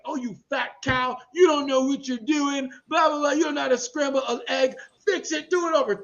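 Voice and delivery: put-on English accent, scolding a cook; Gordon Ramsay. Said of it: "Gordon Ramsay will get on his show and berate children".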